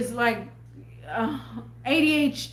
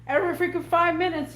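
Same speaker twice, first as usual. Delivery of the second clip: normal talking voice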